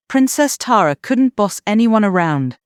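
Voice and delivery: usual speaking voice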